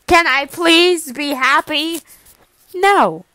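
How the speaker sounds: a loud exasperated whine; exasperated yell. Acted. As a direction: plead in frustration. Exasperated argument.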